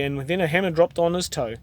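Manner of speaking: plain reading voice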